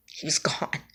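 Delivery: breathy whisper, fading out. Real, not acted. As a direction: let it fall to a whisper. Moment of death retold.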